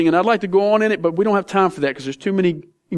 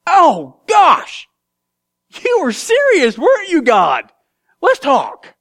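much higher, awed voice of a caught man; Jonah realizing; 10 semitones higher, 4 dB louder, slower than usual. Acted. Jonah in the fish talking to God, high and humbled. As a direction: play Jonah finally getting it, pitch up high. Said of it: "and thinks".

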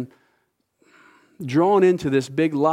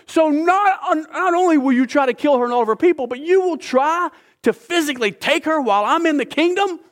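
outraged king; 11 semitones higher than usual. Retold or imitated. The king confronts Haman sprawled over Esther, high and outraged. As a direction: rise up in royal outrage.

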